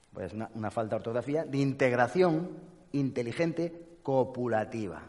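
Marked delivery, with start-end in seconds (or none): none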